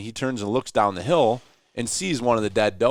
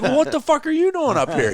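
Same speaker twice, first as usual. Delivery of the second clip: higher, louder, laughing it back; laughing echo; 4 dB louder than usual. Retold or imitated. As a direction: toss his words back through a laugh. Throws the line back, laughing.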